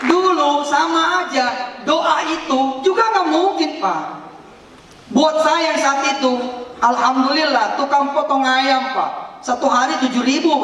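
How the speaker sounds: high-pitched, emphatic sermon delivery; preacher pressing the point; impassioned preaching. Real, not acted. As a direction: raise the pitch and testify to the crowd. Preaches with raised voice.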